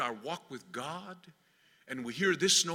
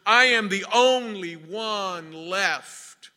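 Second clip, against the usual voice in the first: high, louder complaint, slowing hard at the end; Elijah's impassioned plea; 4 semitones higher, 5 dB louder than usual. Retold or imitated. Elijah repeats his complaint to God, impassioned. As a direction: build the grievance and stretch the last phrase. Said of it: "And again he repeated".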